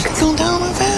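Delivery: put-on high, childlike echo; mock little girl voice. Echoing her clip in a little girl voice. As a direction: parrot it like a creepy doll. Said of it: "like the voice ... possessed doll".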